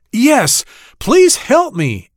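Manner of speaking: high, pleading plea; begging. Acted. Trapped ant begs the passing grasshopper, high pleading. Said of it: "Andy begged".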